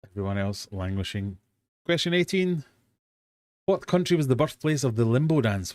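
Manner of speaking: usual speaking voice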